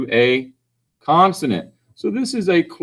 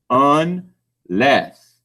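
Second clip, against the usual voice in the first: 'Unless' slowly split into two syllables; sounding it out. Shown as a demonstration. Teacher listens for vowel sounds, sounding out slowly. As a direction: draw out each half of 'Unless' with a gap. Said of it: "I'm listening for my vowel sounds again".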